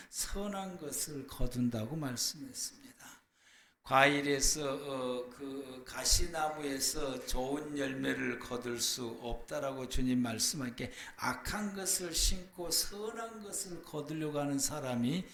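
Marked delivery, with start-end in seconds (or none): none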